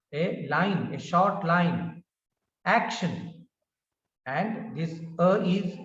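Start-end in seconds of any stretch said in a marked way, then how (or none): 2.6-3.5 "action" with AC prominent; weight the start of "action"; stress on first syllable. Shown as a demonstration. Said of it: "prominence is shown by".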